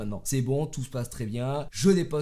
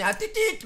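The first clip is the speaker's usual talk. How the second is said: high mock car-horn beeps; squeaky little honk sounds; horn beep imitation.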